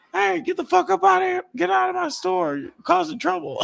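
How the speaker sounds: high squeaky mock yell; hammed-up angry cashier. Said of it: "the cashier is like".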